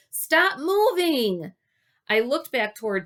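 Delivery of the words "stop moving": high, loud and slow; shouting. Acted. Gary warning Wally on the cracking branch, slow shout. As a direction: drag out the warning. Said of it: "Gary shouted".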